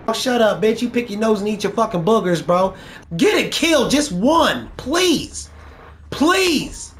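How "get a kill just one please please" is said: high, whiny mock begging; mock pleading. Gamer mockingly begging rival to get one kill. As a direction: beg sarcastically like a whiner.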